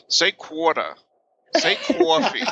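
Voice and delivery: mock teasers requesting a Long Island accent. People pestering a Long Islander, high mimicked Long Island accent. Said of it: "asking me".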